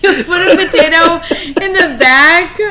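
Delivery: high, slow, laughing question; cracking up in disbelief at the joke. Real, not acted.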